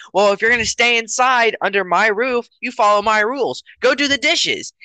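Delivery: high-pitched mock lecture from a parent; hammed-up scolding parent; yelling parent. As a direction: lay down house rules in exasperation. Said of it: "Why are you yelling at me".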